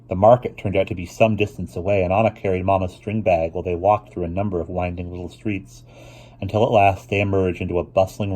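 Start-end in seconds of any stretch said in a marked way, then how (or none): none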